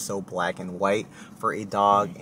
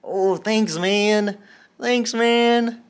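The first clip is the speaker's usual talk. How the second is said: dog praise voice; 11 semitones higher, 5 dB louder, much slower than usual. Real, not acted. Owner praising her dog for giving paw, high baby talk. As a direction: gush over the dog like a proud owner.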